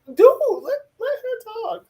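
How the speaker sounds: high, exasperated "let her talk"; exasperated plea. Retold or imitated. Telling Miguel to let Susan talk.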